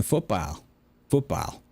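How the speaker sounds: "football" with a drawn, rounded last vowel; exaggerated accent vowel. Shown as a demonstration. Showing the accent works without an R, broad vowel in "football". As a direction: say "football" with a regional "ah". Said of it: "still gonna say the ah".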